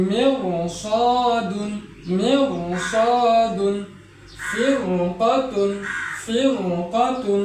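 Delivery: মেরসাদুন and ফিরকাতুন very slowly, with a thick র; heavy ra. Shown as a demonstration. Teacher recites the examples slowly with heavy র. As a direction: draw each word out with a heavy র. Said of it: "এই রটিকে মোটা করে পড়তে হয়".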